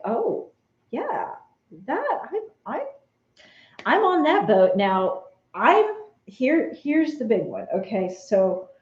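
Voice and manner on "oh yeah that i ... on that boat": mock eager. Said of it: "you're like".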